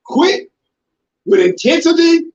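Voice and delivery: a touch of fast pushy talk; mock intensity. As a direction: rattle off quick with intensity. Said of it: "them people spoke".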